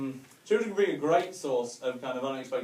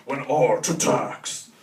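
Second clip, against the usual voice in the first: mock documentary voice; 5 dB louder, much slower than usual. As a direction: intone it like a cheap TV documentary. Mock documentary title voice-over.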